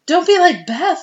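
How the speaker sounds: high, loud comic dismay; hammed-up worried begging; mock pleading. Playful plea to sick friend.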